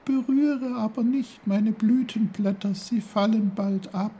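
very high, tiny flower voice; reply as a dainty talking flower; squeaky-high small character; little snowdrop. A narrator's reading. Snowdrop warns.

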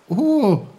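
hohes, lautes Oh; aufgeregter Ausruf. A narrator's reading. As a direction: vor Freude hoch aufrufen. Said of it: "rief Peter". (Peter ruft begeistert hoch und laut)

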